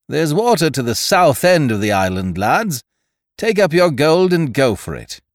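high, loud cheerful taunt; mocking pirate. A narrator's reading. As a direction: jeer at them merrily. Ned taunts the men.

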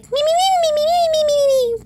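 very high squeaky Muppet bleat; Beaker voice. Retold or imitated. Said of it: "giving someone a Beaker voice".

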